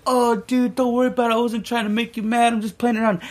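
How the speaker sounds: high, put-on voice of a crazy dealer; mock placating. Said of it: "this dude just like".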